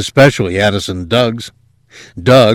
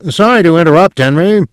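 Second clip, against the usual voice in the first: high, louder on-air interjection; interrupting commentator; 9 semitones higher, 5 dB louder, faster than usual. A narrator's reading. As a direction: cut in urgently on your co-anchor. Colleague breaks in on air.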